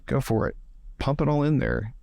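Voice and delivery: ordinary talk, nothing marked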